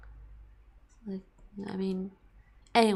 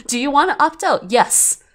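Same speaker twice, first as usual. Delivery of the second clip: laughing mock question; 7 semitones higher, 10 dB louder than usual. Acted mock dialogue with laugh.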